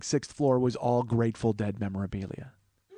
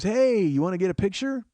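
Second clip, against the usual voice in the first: higher-pitched hail, rising at the end; chirpy photographer; 7 semitones higher, 4 dB louder than usual. Retold or imitated. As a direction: imitate an eager souvenir hawker. Imitates photographer hailing a visitor.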